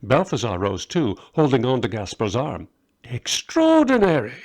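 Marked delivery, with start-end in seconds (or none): 3.1-4.3 high, loud amazement; excited exclamation. A narrator's reading. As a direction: burst out in wonder. Balthazar rises decisively.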